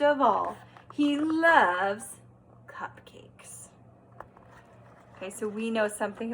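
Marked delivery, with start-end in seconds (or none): 2.7-3.7 breathy whisper on the word. Shown as a demonstration. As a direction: whisper it like a delicious secret. Teacher reading, whispering key word.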